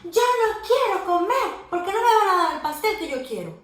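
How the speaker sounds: put-on high, loud child yell; angry shout. Susana shouting from her room at her mom, angry high yell. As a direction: yell back from the bedroom. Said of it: "Gritó".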